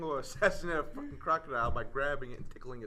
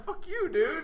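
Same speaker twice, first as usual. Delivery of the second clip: laughing; 6 dB louder, much slower than usual. Real, not acted. Mock outrage while laughing.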